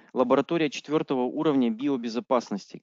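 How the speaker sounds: usual speaking voice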